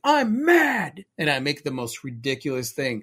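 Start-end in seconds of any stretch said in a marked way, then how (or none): 0.0-1.0 high, loud mock anger; mock mad. Joking to her partner about her fears, hammed-up mad voice. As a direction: ham up being mad. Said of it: "I'll be like".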